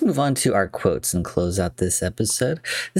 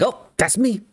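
much higher-pitched exclamation; mock self-recognition; 13 semitones higher than usual. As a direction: spot yourself and pipe up high. Imitating Leo spotting himself on screen.

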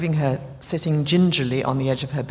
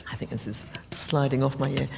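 quick unvoiced whisper to herself; whispered aside; 3 semitones lower, 6 dB quieter than usual. Real, not acted. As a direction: whisper it off-mic, just a side remark. Aside about a slipping microphone.

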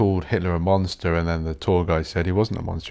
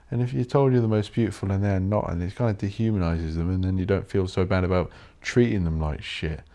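ordinary talk, nothing marked

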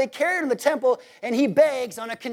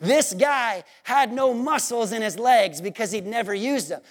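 ordinary talk

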